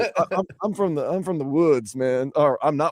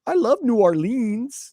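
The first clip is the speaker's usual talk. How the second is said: mock tourist voice; 7 semitones higher, much slower than usual. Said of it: "Oh, I'll be like".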